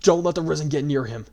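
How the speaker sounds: loud urgent command; shouted order. A narrator's reading. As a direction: call it across the field. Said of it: "Robin ordered".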